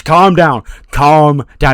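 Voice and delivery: insistent, high-pitched mock intervention; urgent calming. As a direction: try to talk down someone who is raging. Official pleading with enraged wrestler.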